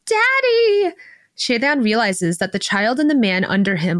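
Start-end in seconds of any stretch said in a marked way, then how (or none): 0.1-0.9 put-on very high, drawn-out child's cry. A narrator's reading. Child calling to the father, high-pitched cry. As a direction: call out long and high like a small child. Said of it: "But the child cries out".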